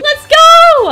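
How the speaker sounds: excited cheer. Real, not acted. Team won safety.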